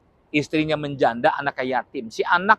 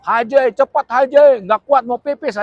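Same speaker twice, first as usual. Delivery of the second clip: loud, slow, high desperate shout; panicky yell; 7 semitones higher, 5 dB louder, slower than usual. Retold or imitated. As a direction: yell it like you badly need the toilet. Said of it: "bisa nggak teriak?". (teriakan panik pura-pura kebelet pipis)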